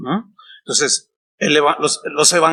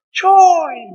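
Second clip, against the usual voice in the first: put-on high excited kid's call, loud and higher; children calling out; 12 semitones higher, 5 dB louder than usual. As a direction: call his name like a happy child running past. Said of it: "así me dicen Chuy". (niños gritando alegres el nombre del pastor, voz aguda)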